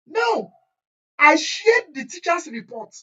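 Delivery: high, loud call for attention, slow; teacher raising the voice to the class. Real, not acted.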